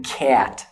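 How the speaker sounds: cat with a strong puff of air on the t; aspirated t in cat. Shown as a demonstration. Teacher showing an aspirated t. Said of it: "There it is again".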